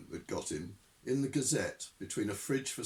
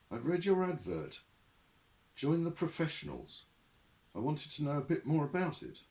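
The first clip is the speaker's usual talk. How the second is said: put-on nervous young man; 8 semitones higher than usual. Newton voiced.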